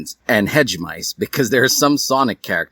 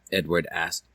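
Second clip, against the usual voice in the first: plain reading voice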